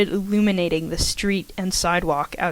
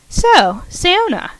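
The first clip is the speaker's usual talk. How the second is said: Karen; 11 semitones higher than usual. Acted. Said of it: "Karen asked".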